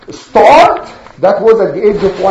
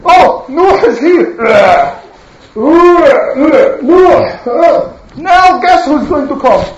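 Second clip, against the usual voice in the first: mocking; 6 semitones higher, 3 dB louder than usual. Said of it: "they do like this".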